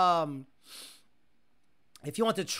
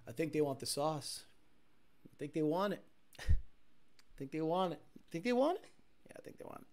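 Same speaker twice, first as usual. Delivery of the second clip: quiet musing; 4 semitones lower, 8 dB quieter than usual. Retold or imitated. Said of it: "And he's like".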